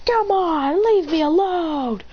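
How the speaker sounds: high, pleading whine; whiny plea. Acted. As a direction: beg to be left alone. Fleeing the attacker.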